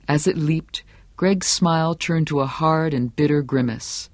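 ordinary delivery, nothing marked

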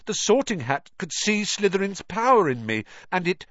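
put-on high, pleading boy's voice; desperate boy. A narrator's reading. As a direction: plead urgently.

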